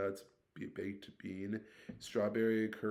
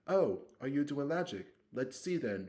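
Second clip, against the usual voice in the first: put-on high, louder eager girl's voice; pushy curiosity, pitched up; eager bossy girl; 6 semitones higher, 5 dB louder than usual. Girl demands to see Ron's magic, high and eager.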